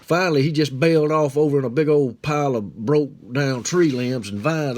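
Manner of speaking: usual speaking voice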